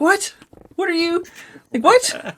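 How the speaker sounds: high squeaky stunned questioning; incredulous what. Retold or imitated. Retelling a video to a friend, high incredulous squeak. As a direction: pitch leaps up in disbelief. Stunned reaction to Cockney swearing.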